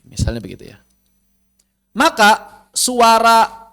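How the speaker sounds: ordinary talk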